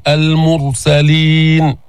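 slow chanted المرسلين with a thick, vowelless ر; drawn-out recitation of المرسلين with emphatic r; heavy ra. Shown as a demonstration. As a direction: darken the ر after the u in المرسلين. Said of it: "فخم الراء".

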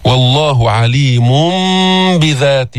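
slow عليمٌ بذات as "alimum-bidhat"; iqlab. Shown as a demonstration. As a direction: chant عليم بذات with the n turned into a held m. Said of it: "إقلاب".

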